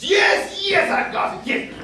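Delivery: bursting with a new idea; yes, I've got it. Acted. Sudden inspiration.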